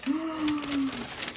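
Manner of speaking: long, high intake of breath; awed gasp. Real, not acted. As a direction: gasp in wonder at the reveal. Awe at handmade journal.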